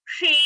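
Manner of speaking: "Shri" with tongue curled back and the vowel held long; retroflex long shi. Shown as a demonstration. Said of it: "Shra मूर्धनी and also Dirgha".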